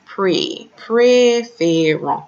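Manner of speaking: "Pré, préférons" with each é like "ay", drawn out; A-Y sound. Shown as a demonstration. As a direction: stretch the "pré" in "préférons" slowly and loudly as "pray". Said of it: "pronounced as A Y".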